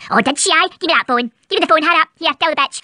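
deep, growling Scottish mum; gruff low mother voice. Acted.

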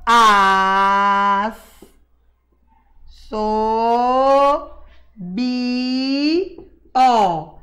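slow, separated syllables As, so, bi, ó with a pause between each. Shown as a demonstration. Teacher showing children how to split assobio, slow separated syllables. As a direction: sound out As, so, bi, ó one syllable at a time. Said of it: "separa bem pausadamente".

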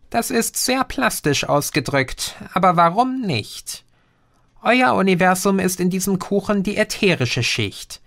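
a patient know-it-all explaining; Green's voice. Acted. Green answers about cake. (leicht höher gesprochener Außerirdischer, belehrend)

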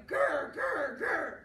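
growled grr, grr, grr; T-Rex growl. Shown as a demonstration. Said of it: "You're gonna go".

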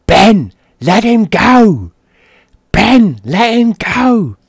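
high, loud drawn-out scream. Retold or imitated. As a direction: yell it slow like a screaming nan. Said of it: "I can hear my nan screaming".

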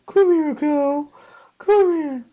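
high, loud sing-song call, drawn out; calling a cow. Real, not acted. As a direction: coax the cow over in a high voice.

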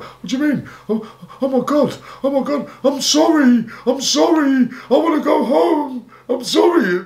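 very high, hammed-up wailing apology; acted-out crying. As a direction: bawl like a terrified kid pleading to go home. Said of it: "Yeah, I cried".

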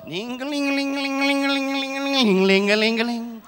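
sung ling-a-ling bell jingle; imitated church bell. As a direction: ring it out like a little bell.

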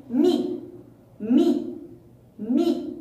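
"مي" repeated as a separate syllable, م with an i; kasra syllable drill. Shown as a demonstration. Teacher leading pupils to repeat after her, kasra syllable drill. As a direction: sound out "مي" distinctly, pausing between repeats. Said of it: "أن أنطق الحروف التالية".

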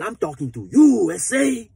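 loud, high threatening retort; imitating a hostile inmate; mock menacing. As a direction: throw it at him like a threat. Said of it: "the way he got it".

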